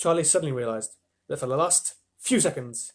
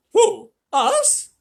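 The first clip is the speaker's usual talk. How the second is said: much higher, loud squeak of disbelief; astonished Grandma Josephine; 9 dB louder than usual. A narrator's reading. Old grandmother shocked at being asked to work, squeaking high. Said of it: "said Grandma Josephine".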